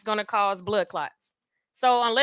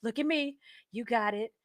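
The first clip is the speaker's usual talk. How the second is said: coaching; 2 semitones higher, 6 dB quieter than usual. Retold or imitated. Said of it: "She was like".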